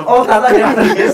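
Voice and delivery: surprised viewer voice. Retold or imitated. Imagined viewer's surprised reaction.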